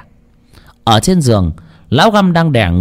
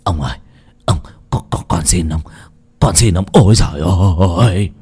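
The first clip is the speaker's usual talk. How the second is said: desperate begging; 5 semitones lower than usual. Acted. Beaten naked healer begging for mercy, low and broken. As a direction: beg for your life in low gasping pieces. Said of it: "rối rít van xin".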